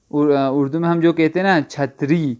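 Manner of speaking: usual speaking voice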